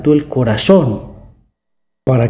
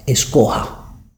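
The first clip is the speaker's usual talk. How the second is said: breathy near-whisper, much softer; hushed urging; 3 semitones lower, 5 dB quieter, slower than usual. Acted. God urging the listener to choose, in a hushed whisper. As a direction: lean in and whisper the choice.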